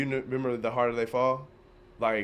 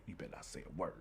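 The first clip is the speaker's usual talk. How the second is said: low, hushed mock threat; mother's warning; 7 semitones lower, 15 dB quieter, faster than usual. Mom warning her daughter to keep silent, hushed mock warning. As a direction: drop soft and low, as a warning. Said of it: "her mom was like".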